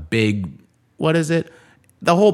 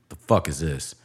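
muttered confrontation; 5 semitones lower, 5 dB quieter than usual. Retold or imitated. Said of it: "I didn't say that".

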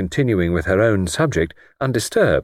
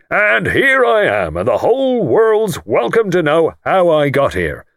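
higher, louder proud crowing; self-made lord showing off to the guest; triumphant; 7 semitones higher, 3 dB louder than usual. Acted. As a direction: finish with a swelling flourish. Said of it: "wound up triumphantly".

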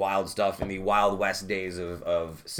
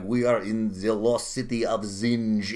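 slow, lightly put-on Tim Curry accent; a hint of Tim Curry's odd accent, slowly; 3 semitones lower, slower than usual. Host recalls Tim Curry's accent, lightly put-on slow. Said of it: "he has this terrible accent the whole time".

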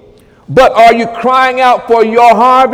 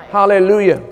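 usual speaking voice

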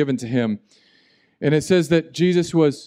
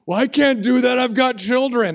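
high, louder mock complaint of a parent; whiny objecting parent; 10 semitones higher, 5 dB louder than usual. Church parent objecting to a beach day, mock whiny. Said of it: "most rebellion".

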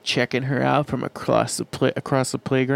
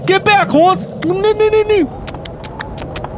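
high-pitched cries to an animal; mock panicked calling; 3 dB louder, much slower than usual. Rustic character calling back a horse, high mock panic. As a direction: call out high in pretend alarm.

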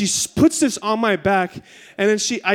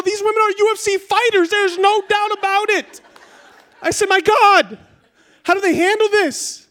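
high-pitched comic outcry; pained exclamation; 13 semitones higher, 4 dB louder than usual. Retold or imitated. Husband being waxed marvels at women's toughness, pained outcry. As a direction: cry out in mock agony, voice way up.

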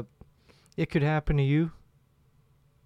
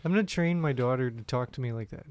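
ordinary delivery

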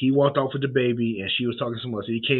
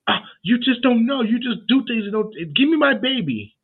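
much higher, louder mock-outraged mother; mock shrill mom; 12 semitones higher, 5 dB louder than usual. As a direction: ham up a frantic mom snatching her baby back. Retold mother grabbing the baby back.

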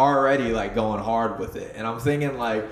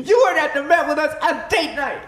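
very high, loud squeal through laughter; laughing mock outburst; 5 dB louder than usual. Joking with friend about date night, high laughing mock shout. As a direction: shriek it in mock protest, cracking up.

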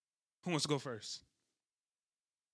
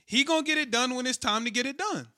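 much higher and louder, hyping him up; hyped up; 8 semitones higher, 7 dB louder than usual. Real, not acted. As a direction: sell your pick with big energy. Hyping up his pick.

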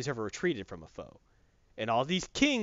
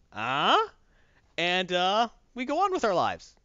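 a light smug emperor, pitched higher; 7 semitones higher, 4 dB louder than usual. Retold or imitated. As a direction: lightly play a gloating emperor offering false peace. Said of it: "And he's like".

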